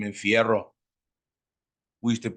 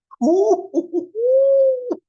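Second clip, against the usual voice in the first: loud squealing laughter; high cackling laugh; 9 dB louder than usual. Real, not acted. Cracks up at his story.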